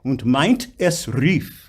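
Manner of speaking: slow "meint es rief", "es" freshly attacked; reattacked vowel. Shown as a demonstration. Coach showing singer the reattack, "es" begun afresh after "meint". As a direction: separate "meint" from "es" with a new onset. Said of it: "Reattack the word that begins with a".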